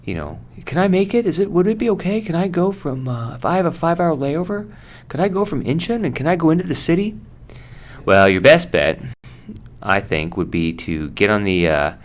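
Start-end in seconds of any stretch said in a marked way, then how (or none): 0.6-7.2 high, fast, pleading traveller question; high passenger voice. Acted. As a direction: play an anxious passenger asking to leave the airport. Passenger asking crew, acted.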